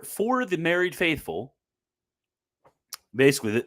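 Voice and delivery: ordinary delivery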